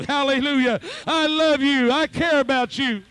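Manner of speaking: loud, high preaching at full force; fiery preaching. Acted. Preacher roaring God's love to the congregation. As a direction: hammer it home to the congregation.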